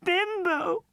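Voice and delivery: put-on high, slow, crying child's voice; a girl wailing for her teddy; despairing cry. Girl dismayed at the news.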